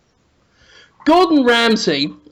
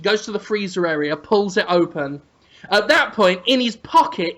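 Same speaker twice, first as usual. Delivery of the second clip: normal talking voice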